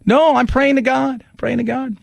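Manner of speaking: a hint of a stubborn woman digging in; stubborn refusal.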